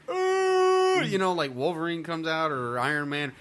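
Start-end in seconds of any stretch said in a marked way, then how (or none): 0.1-1.0 high, loud wordless crowd whoop; mock fan cheer. Fans greeting a hero's first scene, mock cheering. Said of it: "people are like".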